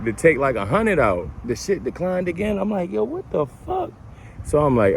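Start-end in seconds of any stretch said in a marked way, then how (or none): 1.4-3.9 high, disbelieving yo what the fuck; shocked disbelief. Retold or imitated. Telling of the declined card, shocked high pitch. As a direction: voice jumps up in shock.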